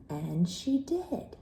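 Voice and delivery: plain reading voice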